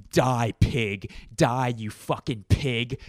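slow, menacing mock threat; hammed-up crazy comedian snarl; mock psycho comedian. Comedian mocks rude heckler.

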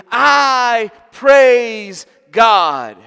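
loud, high, drawn-out proclamation; shouted praise. Retold or imitated. Preacher echoing the old man's loud praise. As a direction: proclaim it slowly at full voice.

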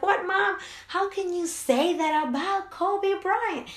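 high, slow outraged protest; indignant kids. Retold or imitated. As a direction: gasp it out like a scandalised kid. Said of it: "Him and my son are like".

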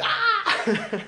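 high shriek, laughing; mock scream. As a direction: scream like a startled girl. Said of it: "And they'll be like".

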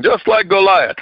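ordinary talk, nothing marked

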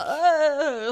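high, loud wailing retch; hammed-up vomit wail; crying retch. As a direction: imitate sobbing while being sick. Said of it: "Og så gråter jeg og spydde".

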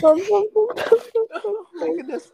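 slow high wheezing laugh; helpless laughing. Real, not acted. Giggle fit continues.

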